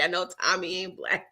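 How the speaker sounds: ordinary talk, nothing marked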